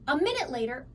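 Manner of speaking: ordinary delivery, nothing marked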